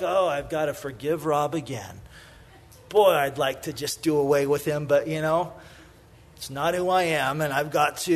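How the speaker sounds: begrudging. Pretend.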